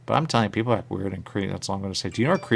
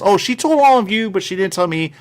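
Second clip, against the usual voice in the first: mock complaint; 10 semitones higher, 6 dB louder than usual. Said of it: "he wanted to say this".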